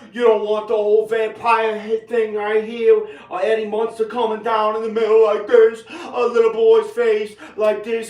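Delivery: high, loud yelling that climbs to a scream; real enraged shouting; furious rant. Real, not acted. Furious rant ending in an insult.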